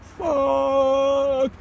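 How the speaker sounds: mock-despairing wail of a swear; retold dismay. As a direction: stretch it out in helpless dismay. Own reaction to losing phone.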